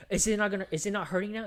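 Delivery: acted vengeful needling; retold taunt. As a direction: taunt with mock insistence, repeating yourself. Voicing character's taunt while beating.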